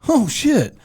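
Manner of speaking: high-pitched playful dismay; acted uh-oh moment; mock alarm. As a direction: react in exaggerated alarm. Imagined reaction to angry text.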